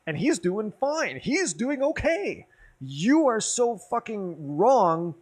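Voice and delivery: high, slow, stretched-out angry yell; retold furious rant. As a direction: lean into every word like you've lost it. Retold rant defending a teammate.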